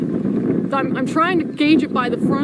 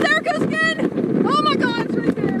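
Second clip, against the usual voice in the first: panicking gamer; 10 semitones higher than usual. Real, not acted. Tornado touching down nearby.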